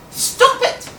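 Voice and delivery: high, loud scolding yell. A narrator's reading. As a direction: yell it sharply at a misbehaving pupil. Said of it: "I yelled".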